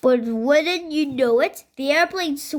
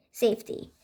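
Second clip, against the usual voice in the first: ordinary delivery, nothing marked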